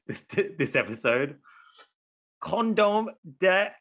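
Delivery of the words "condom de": 독일어 발음 시도; German pronunciation. Shown as a demonstration. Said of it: "you get to hear my German".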